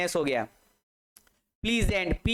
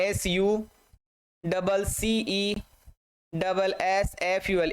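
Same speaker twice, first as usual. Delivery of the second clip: very slow, stretched-out letters; slow spelling; slower than usual. Shown as a demonstration. Teacher spelling successful slowly for students. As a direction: give each letter its own time. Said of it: "teacher spelling out letters".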